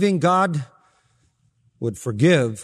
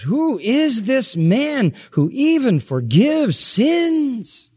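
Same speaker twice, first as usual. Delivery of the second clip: high, drawn-out, scandalised question; acted incredulous indignation; 9 semitones higher, much slower than usual. Preacher voicing shocked guests reclining at the table, incredulous. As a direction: stretch every stressed word high in mock outrage.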